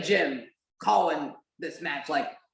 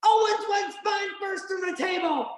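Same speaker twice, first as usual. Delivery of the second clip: imitated high-pitched commentator shout; Michael Cole yell; 10 semitones higher than usual. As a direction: holler it like an announcer calling a crash. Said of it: "Michael Cole yells".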